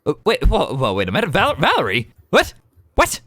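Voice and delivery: high, loud disbelief; startled brother. Acted. Brother spotting his long-lost sister, high loud shock. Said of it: "and just go".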